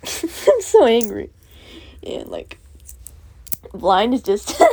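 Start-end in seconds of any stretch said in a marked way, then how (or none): 0.0-1.3 slow, laughing through the words; laughing admission. Real, not acted. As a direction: confess it giggling. Laughing at his own angry note.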